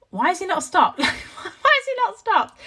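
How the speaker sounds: high laughing exasperation; laughing disbelief. Retold or imitated. Mum recalling her inner thoughts, laughing in disbelief. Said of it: "In my head, I was thinking".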